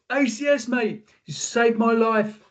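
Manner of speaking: high, drawn-out call to a stranger; mock-holler thanks along the carriage; imitating shouting. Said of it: "shouting down the tube".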